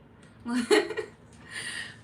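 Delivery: high, loud burst of laughter; laughing. Real, not acted. Amused by the question about love, high loud laugh. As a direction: crack up laughing.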